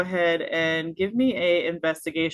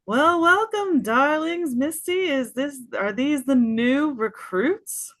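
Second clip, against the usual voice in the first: much higher, loud theatrical welcome; grand Vulpin lady; 9 semitones higher, 3 dB louder than usual. Acted. Vulpin leader welcomes new recruits, high and theatrical. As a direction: purr the darlings like a grande dame. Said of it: "she's gonna go".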